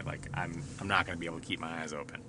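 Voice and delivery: drowsy, fast mumble; sleepy voice. Pretend. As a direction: sound half asleep. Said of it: "eight o'clock on a fucking Thursday".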